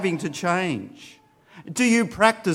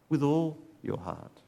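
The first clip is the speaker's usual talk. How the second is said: softer repeat; 6 dB quieter than usual. Shown as a demonstration. Preacher repeating the verse to the congregation, softly. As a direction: let the phrase "With all your heart" fall quiet and inward.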